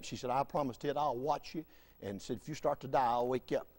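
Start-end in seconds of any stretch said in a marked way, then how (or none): 0.3-1.6 much softer, lower promise; gently voiced imitation of a reassuring wife; soft reassurance. Wife promising her frightened husband she will watch him sleep, soft reassurance. 2.4-3.7 low, very quiet promise; soft reassurance. Retold or imitated. Wife promising to wake her husband if he starts dying, soft reassurance.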